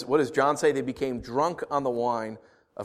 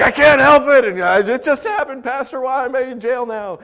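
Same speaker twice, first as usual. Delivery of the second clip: high, loud mock-helpless whine; comic pleading to the pastor; mock excuse; 11 semitones higher, 10 dB louder than usual. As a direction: protest innocence in a hammed-up high voice. Voices absurd excuse to pastor.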